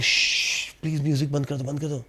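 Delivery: quick, soft, urgent whisper; hushed whisper. Retold or imitated. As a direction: hush him so the call stays secret. Girlfriend hushing during call.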